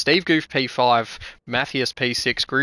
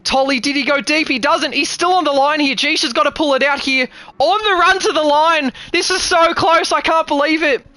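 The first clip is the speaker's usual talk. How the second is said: rising, louder play-by-play building to the finish; live race call; 12 semitones higher, 5 dB louder than usual. Real, not acted. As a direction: call the final lap faster and higher as it tightens.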